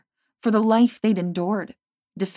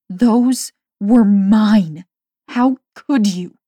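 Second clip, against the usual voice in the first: hurt; 3 semitones higher, 10 dB louder, much slower than usual. A narrator's reading. Hurt accusation.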